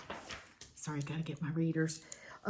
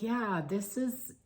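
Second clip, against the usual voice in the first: relaxed island chill; 4 semitones higher, slower than usual. Retold or imitated. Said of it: "we were all kinda like".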